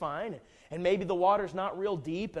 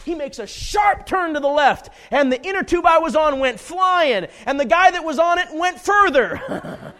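loud, higher blow-by-blow, building with each phrase; excited climax; 7 semitones higher, 12 dB louder than usual. Retold or imitated. Storyteller reaching the moment he was flung off, excited climax. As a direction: tell the wipeout at full volume.

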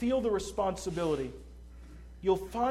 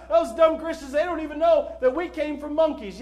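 loud, high imitation of a scoffer; mocking laughter; 7 semitones higher, 9 dB louder than usual. Scoffer hearing the gospel from Christians, imitated mocking laughter. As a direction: laugh and jeer as a mock unbeliever. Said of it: "somebody starts laughing and said".

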